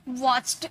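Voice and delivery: watched with a stray "t" sounded by the "ch"; faulty watched, T not silent; audible T. Shown as a demonstration. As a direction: say watched as the mistaken form of watch, T heard. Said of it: "टी को च में sound आ ही जाता है".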